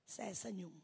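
ordinary talk

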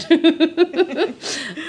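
high, quick giggle; rippling high laugh. Real, not acted. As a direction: giggle at your own punchline. Laughing after own punchline.

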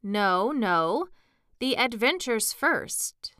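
emphatic, impatient objection. A narrator's reading. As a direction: cut in and insist impatiently. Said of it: "in an impatient tone".

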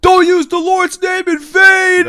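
very high, slow screech; loud, drawn-out mock outrage; homeless man impression. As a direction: scold in a hammed-up wail. Homeless man scolds, imitated.

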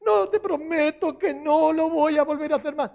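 high-pitched, hammed-up wailing promise; crying child. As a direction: wail and plead like a tearful child. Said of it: "Y viene llorando".

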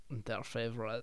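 very soft mock French accent; Bruce Campbell. Maitre d' seeing the song, in a soft mock French accent. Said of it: "he plays a French person very well".

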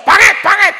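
loud taunting yell; mock insult shout. Preacher acting an angry neighbor shouting insults. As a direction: yell it at the person beside you. Insulting the person beside you.

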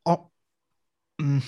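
normal talking voice

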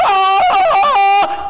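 high, loud, long Tarzan-style holler; whooping jungle call; jungle vine yell. Acted. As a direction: yodel out as you swing through trees. Imitates swinging on jungle vine.

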